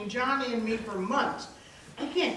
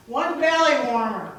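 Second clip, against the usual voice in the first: calling out order; 9 dB louder than usual. Acted. Waitress calls order to kitchen.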